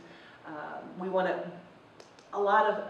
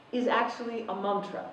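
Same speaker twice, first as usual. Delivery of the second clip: normal talking voice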